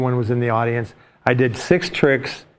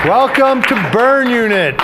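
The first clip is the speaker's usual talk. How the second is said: big show opening; 8 semitones higher, 4 dB louder, slower than usual. Acted. Host opens show.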